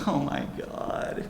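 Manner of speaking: imitated slow, whispery horror; hushed dismay. Retold customer learning all stones are diamonds, hushed dismay. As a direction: draw it out softly as the dread sinks in.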